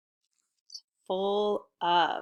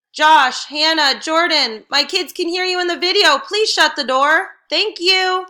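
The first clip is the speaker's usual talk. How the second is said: calling out; 8 semitones higher, 13 dB louder than usual. Real, not acted. Calls family to quiet down.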